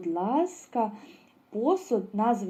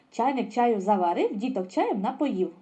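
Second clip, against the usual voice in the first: faster still. Shown as a demonstration. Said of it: "І останній раз іще швидше".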